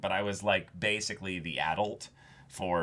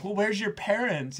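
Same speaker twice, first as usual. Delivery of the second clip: high-pitched mock questioning; pitched-up, querying impression; nosy parent; 11 semitones higher than usual. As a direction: imitate a doubtful parent in a raised voice. Retold parent questioning babysitter.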